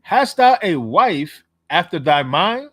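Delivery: slow, loud, solemn reading voice; scripture reading. Shown as a demonstration. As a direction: read it out like scripture in church. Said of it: "Read that out".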